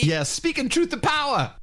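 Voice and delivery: high mocking cheer; jeering high praise; sarcastic cheer.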